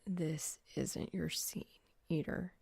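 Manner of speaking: slow hushed whisper, alone in the car; muttered to himself. Acted. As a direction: breathe it to yourself, slowly.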